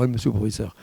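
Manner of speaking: normal talking voice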